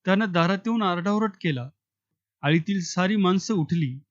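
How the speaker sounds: ordinary delivery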